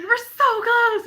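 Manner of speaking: high, loud, stretched-out thrill; excited squeal. Retold or imitated. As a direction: draw it out, thrilled to be close. Retells own excitement nearing two thousand.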